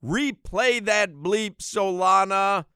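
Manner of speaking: loud, slow hype call; excited call. Real, not acted. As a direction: call it out, hyped up. Hyped, calling to co-host.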